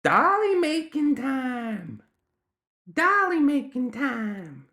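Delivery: put-on very high child squeal, words stretched long; drawn-out demanding child gremlin; forceful gremlin child. A narrator's reading. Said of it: "the gremlin child said again forcefully".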